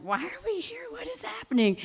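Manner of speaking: high bewildered whisper; sinking into couch. Retold or imitated. Dad and stepmom whispering in dismay on the couch. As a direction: whisper it, stunned and shrinking. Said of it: "sinking into the couch".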